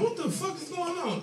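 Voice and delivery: high, soft panicked squeak. Retold or imitated. Comic putting out a melting joint, high panic. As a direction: yelp it under your breath.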